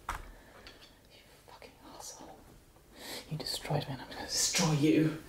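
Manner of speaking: hissed, breathy threat; venomous whisper. Acted. [name] hisses a revenge threat at the Hydra. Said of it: "and just go".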